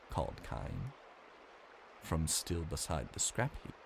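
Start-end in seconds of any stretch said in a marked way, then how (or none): none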